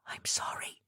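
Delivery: a hushed, breathless apology; barely voiced, ashamed whisper. A narrator's reading. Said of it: "Abby whispered".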